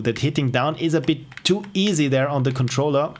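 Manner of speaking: ordinary delivery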